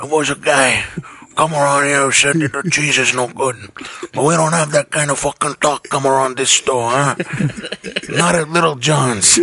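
lightly voiced tough-guy shop owner; a hint of menacing mobster; mob pizzeria owner. Plays the mob pizza owner.

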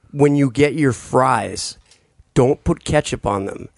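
usual speaking voice

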